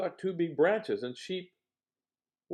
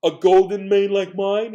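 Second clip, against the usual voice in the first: lion's voice; 4 semitones higher, 13 dB louder than usual. Acted. Lion brags about his mane to the animals, loud. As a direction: boast about the golden mane loudly. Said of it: "he said".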